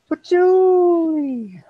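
loud slow sneeze shouting Julie. Shown as a demonstration. Demonstrates his sneeze sound.

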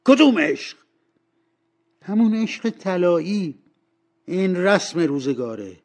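high, loud, scornful retort; heated young man defending himself; angry. Acted. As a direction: burst out, then argue back forcefully. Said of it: "اسکروچ جوان با عصبانیت گفت".

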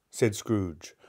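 ordinary delivery, nothing marked

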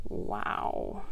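soft, whispered wonder; hushed wow. A narrator's reading. Reader admiring the finished web, hushed and awed. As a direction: whisper it in awe.

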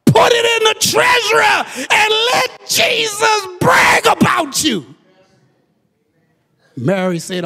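high, loud shouted sermon cadence; shouted preaching in call-and-answer rhythm; full-force preaching. Acted. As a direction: hammer each example home to the congregation. Preaches through biblical examples.

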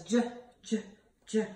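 "J" as a short juh, not the letter name; letter sound demo. Shown as a demonstration. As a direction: sound out "J" in separate bursts. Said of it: "Jerry Jellyfish says".

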